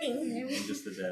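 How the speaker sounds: lightly put-on, high and soft mock dad voice. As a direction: say it in a small mock voice. Repeats joke in put-on voice.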